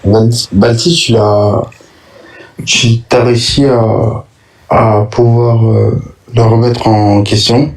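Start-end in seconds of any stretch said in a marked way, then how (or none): none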